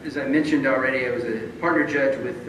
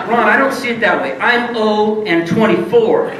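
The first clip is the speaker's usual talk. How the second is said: louder, slow, laughing through it; laughing protest; 8 semitones higher, 8 dB louder than usual. Retold or imitated. Correcting Ron about his losing record, laughing. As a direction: stretch it out with a laugh.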